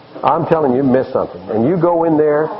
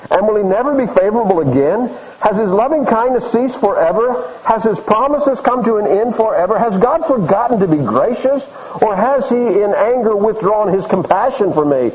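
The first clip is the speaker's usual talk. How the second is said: raised, insistent pitch on each question; anguished questioning; 7 semitones higher than usual. Acted. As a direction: put the psalmist's complaint to God, voice held high. Psalmist's anguished questions to God acted out.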